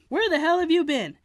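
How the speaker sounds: loud, high angry holler; father yelling at the kids. A narrator's reading. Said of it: "Daddy hollered".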